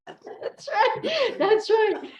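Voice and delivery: real laughter in a high voice; laughing. Real, not acted. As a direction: giggle out the words while agreeing. Laughing after the accent joke.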